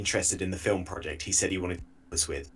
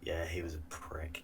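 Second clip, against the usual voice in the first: whispering; 4 semitones lower, 13 dB quieter than usual. Real, not acted. Quiet verdict on the guy.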